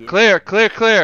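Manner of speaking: high, loud callout; shouting. Real, not acted. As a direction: call the room clear at full voice. Room clearing callout.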